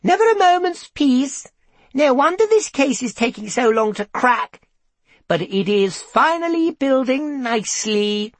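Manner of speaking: high-pitched, loud character voice; calling out. A narrator's reading. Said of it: "someone called his name".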